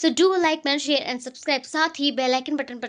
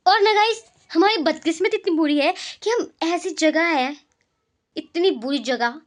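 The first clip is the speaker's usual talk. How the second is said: ordinary delivery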